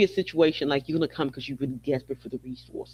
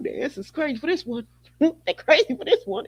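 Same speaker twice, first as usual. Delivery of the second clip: laughing; 10 semitones higher than usual. Real, not acted. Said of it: "I can't stop laughing at them".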